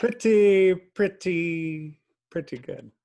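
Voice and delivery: catchphrase impression starting high, falling and softening; drawn-out, teasing TV comedian catchphrase; Larry David. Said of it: "he's basically Larry David".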